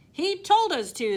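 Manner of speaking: loud, high blame; cried. Acted. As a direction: shout it together. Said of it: "they cried".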